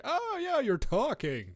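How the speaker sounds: high mock-enthusiastic quote; jokey, exaggerated agreement. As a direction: swoop up high on the opening. Mocks wiggling faces of game characters.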